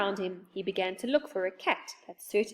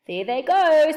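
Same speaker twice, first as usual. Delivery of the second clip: loud, high gleeful exclamation; delighted; 6 semitones higher, 10 dB louder than usual. A narrator's reading. Moonface watching the pair tumble away, loudly delighted. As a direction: crow it out, stretching the last word. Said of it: "said Moonface in delight".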